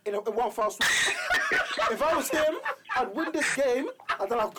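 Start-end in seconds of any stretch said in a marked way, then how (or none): 0.9-1.9 sudden high laugh; a quick high unvoiced laugh; burst of laughter. Real, not acted. Laughing at Moyes joke.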